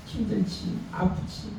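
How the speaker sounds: put-on soft comforting murmur to a child; quiet soothing voice; tender hush. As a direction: hush it gently like soothing a baby. Parent soothing sick child.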